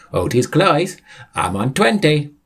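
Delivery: chirpy high-pitched character; perky high voice; high cheerful Sam voice. Acted.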